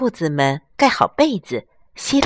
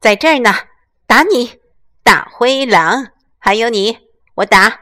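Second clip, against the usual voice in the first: loud, forceful battle cry; 兔妈妈边拍垃圾桶边赶狼大喊; fierce shouting while striking; 5 semitones higher, 8 dB louder than usual. Acted. Mother beats bins with broom. (凶狠地喊打)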